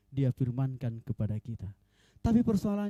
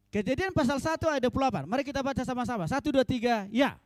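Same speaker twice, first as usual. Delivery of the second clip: raised, higher preaching voice; preacher calling congregation; 9 semitones higher, 4 dB louder than usual. Real, not acted. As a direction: lead the congregation into a reading. Leads congregation in reading verse.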